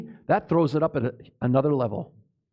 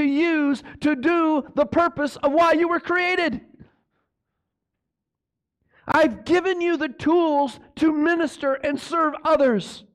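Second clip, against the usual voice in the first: voicing Christ; 9 semitones higher than usual. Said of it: "he's saying".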